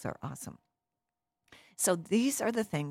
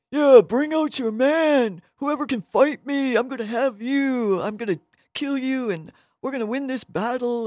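obnoxious giant; 8 semitones higher, 7 dB louder than usual. Acted. Giant Goliath challenging the enemy army, obnoxious and loud. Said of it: "talking so obnoxious".

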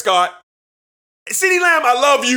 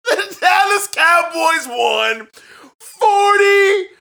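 excited shout; 7 semitones higher, slower than usual. Real, not acted. Calling the winning kick.

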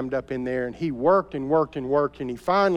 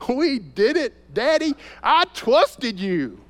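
put-on much higher, slow, excited child's voice; proud little boy; 9 semitones higher, 4 dB louder, slower than usual. Small son cheering to his father after the ride, high and excited. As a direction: crow it like a thrilled three-year-old.

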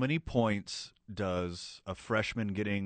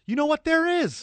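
excited; 8 dB louder than usual. Real, not acted. Finds Urban Dictionary entry.

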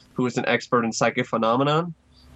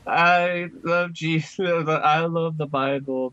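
put-on high, slow, obnoxious little kid; 5 semitones higher, slower than usual. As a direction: imitate a preachy little kid, drawn out. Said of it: "just the most obnoxious little kid voice you can imagine".

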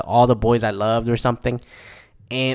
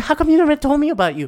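high-pitched, rushed mimicry; mock a clueless girlfriend, high and fast; squeaky mock-innocent question; mocking imitation; faster than usual. Mimics the character's naive question.

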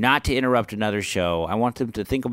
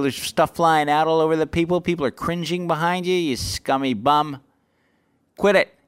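raised, indignant put-down ending in a sharp order; retold berating; 6 semitones higher than usual. Comic insulting a head-scratcher in the crowd, retold berating. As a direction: re-enact chewing out a heckler.

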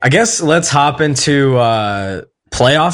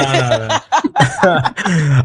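laughing; much slower than usual. Real, not acted. Laughing off a teasing question.

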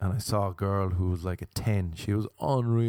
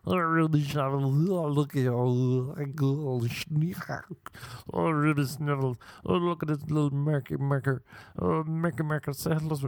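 higher cooing voice for a dog, mocking; pet baby talk; 6 semitones higher than usual. Mocking a girl cooing at her pet, baby talk. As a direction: coo like you're talking to a puppy, hammed up. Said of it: "the voice that she uses for her pet".

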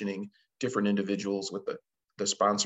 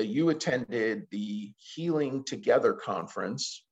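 normal talking voice

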